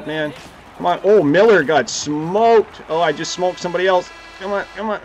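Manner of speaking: ordinary delivery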